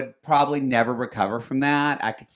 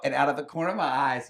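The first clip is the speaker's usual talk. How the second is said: ordinary delivery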